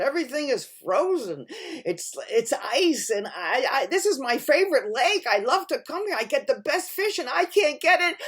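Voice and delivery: high, loud lament; distressed bear complaint. A narrator's reading. Bear tells fox of the frozen lake, high and upset. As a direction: complain in a rising, agitated rush.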